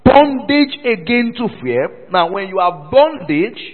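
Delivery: loud, high pulpit proclamation; full-force preaching. Real, not acted. Preacher reading the verse to congregation, full-force preaching. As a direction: proclaim the verse and call for a response.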